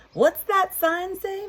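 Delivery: squeaky high cow asking, puzzled. Acted. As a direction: pitch way up as Mabel the cow. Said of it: "Mabel asked".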